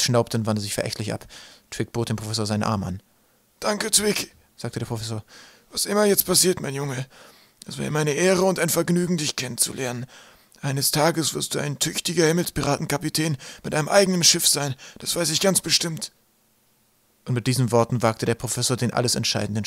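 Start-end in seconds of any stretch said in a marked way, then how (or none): 3.6-4.3 high, frail professor voice; weakened old scholar; grateful old professor. Acted. Narrator plays the weakened professor. 5.7-16.1 high professor voice, earnest farewell; tender farewell from an old scholar; old professor, heartfelt. Acted. Professor's solemn farewell to Twig.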